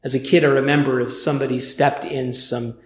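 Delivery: normal talking voice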